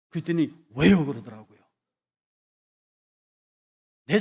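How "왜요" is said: surprised protest. Retold or imitated. Student protests.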